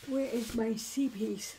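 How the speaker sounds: talking through a yawn; words stretched by a yawn. Real, not acted. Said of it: "I'm just yawning over here".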